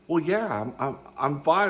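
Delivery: mock being too winded from laughing to get it out; breathless with laughter. To his ex-wife, retelling answering breathless from laughing. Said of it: "I couldn't catch my breath 'cause I was laughing".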